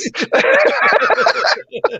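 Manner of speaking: squealing giggle; high giggle. Real, not acted. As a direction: let a high delighted giggle out. Laugh after retold reply.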